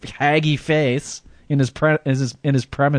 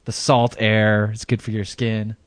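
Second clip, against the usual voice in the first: ordinary talk, nothing marked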